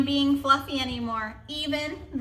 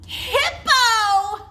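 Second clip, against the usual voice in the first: much higher and louder, squealed through laughter; burst out laughing; 10 semitones higher, 6 dB louder than usual. Acted. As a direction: blurt it out mid-giggle. Rhino blurts name laughing.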